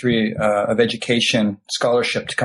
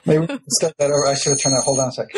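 ordinary talk

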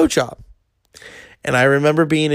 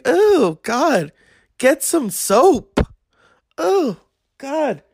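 mock disgust; 9 semitones higher, much slower than usual.

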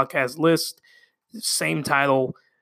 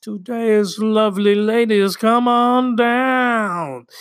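higher, drawn-out announcer call; game-show announcer; 5 semitones higher, 4 dB louder, slower than usual. Pretend. Host introducing today's list, game-show announcer voice. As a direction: stretch it out like calling contestants down.

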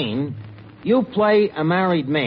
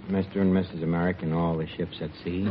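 mock newscaster; 11 semitones lower, 8 dB quieter, faster than usual. Mock newsreel sign-off phrase.

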